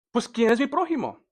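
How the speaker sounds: agudo y rápido, desafiante; tírale la pregunta a Jesús, agudo; pregunta del intérprete. Acted. Voz del intérprete citada. (el intérprete, queriendo justificarse, pregunta agudo y rápido)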